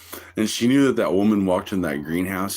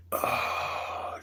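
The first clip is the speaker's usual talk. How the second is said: ordinary talk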